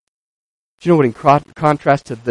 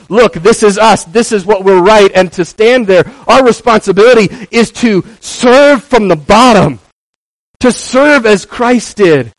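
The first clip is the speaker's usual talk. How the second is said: loud, high preacher's call; fervent preaching; 8 semitones higher, 7 dB louder than usual. Acted. Pastor exhorting the congregation, fervent preaching. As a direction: preach it out to the room.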